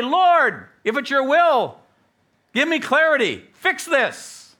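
loud, high impassioned plea; urgent shouted prayer; crying out in prayer. Acted. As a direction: cry out to God at full voice. Preacher acts out a desperate prayer.

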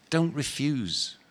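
plain reading voice

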